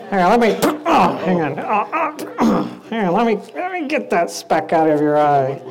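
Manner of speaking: grunting, halting effort while talking; mock straining, breaking off mid-phrase; fumbling effort. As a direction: struggle to get at something awkward. Skit, straining to remove speck.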